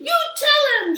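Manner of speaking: high, loud cheer; the crowd cheering Amelia on, loud and high; crowd shout. A narrator's reading. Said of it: "shouted the crowd".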